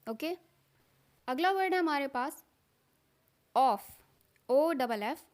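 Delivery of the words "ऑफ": ordinary talk, nothing marked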